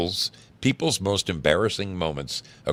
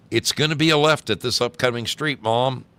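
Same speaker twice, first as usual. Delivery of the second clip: put-on slightly higher, helpful kid giving directions; 9 semitones higher, 6 dB louder than usual. A narrator's reading. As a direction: offer the directions helpfully to mom. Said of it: "Her son very helpfully said".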